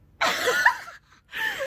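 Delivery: high shrieking laugh; loud burst of laughter. Real, not acted.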